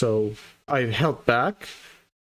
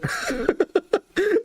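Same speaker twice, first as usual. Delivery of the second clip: high giggle. Real, not acted. Laughing at the tickle monster.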